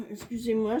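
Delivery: coughing then apologizing quickly; cough, then a rushed excuse me; dry cough. Real, not acted. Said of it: "je tousse encore un petit peu".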